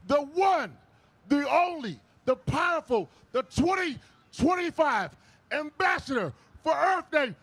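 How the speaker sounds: building hype introduction; rising announcer build-up; rally speech. Real, not acted.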